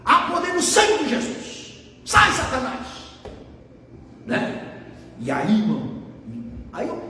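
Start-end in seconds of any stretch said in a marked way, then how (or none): 0.0-2.9 raised-pitch command against the devil; re-enacted outburst from the pulpit; sudden preacher's shout. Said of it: "de repente eu dou um grito".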